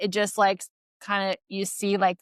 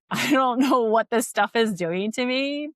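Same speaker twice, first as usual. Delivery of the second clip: laughing; 4 semitones higher, 4 dB louder than usual. Retold or imitated. Patient retells puzzled change, laughing.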